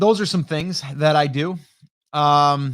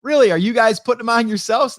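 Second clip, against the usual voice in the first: high, louder, amazed question; impressed surprise; 9 semitones higher, 6 dB louder than usual. Retold or imitated. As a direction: sound genuinely impressed. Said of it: "And I'm like".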